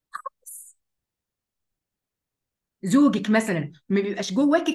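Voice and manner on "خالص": a whispered final word to drive the point home. Acted. A preacher whispers the last word to the women listening. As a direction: breathe the word out with no voice.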